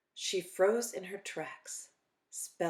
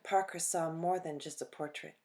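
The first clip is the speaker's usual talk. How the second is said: ordinary delivery, nothing marked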